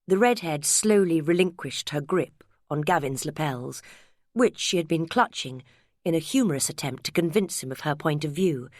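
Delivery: plain reading voice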